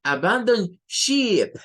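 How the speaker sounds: "Abandon ship" shouted with a long ee, sounding like sheep; mock alarm, stretching "ship" into sheep; "Abandon ship" wrongly said with a long ee. Shown as a demonstration. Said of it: "Imagínense si dijésemos algo como".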